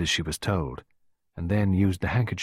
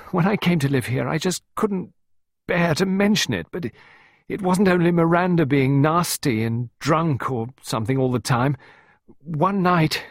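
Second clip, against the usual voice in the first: high, loud, halting outpouring; desperate confession; 9 semitones higher, 5 dB louder than usual. A narrator's reading. Pippa confiding in Clarissa about Oliver, desperate. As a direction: force out the story in distress. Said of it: "sounded even more desperate".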